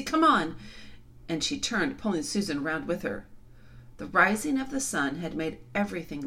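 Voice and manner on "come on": urgent call. A narrator's reading. Girl urging her sister.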